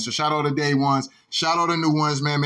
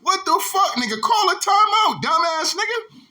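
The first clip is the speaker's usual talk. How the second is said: exasperated yell; 12 semitones higher than usual. Retold or imitated. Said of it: "I'm like".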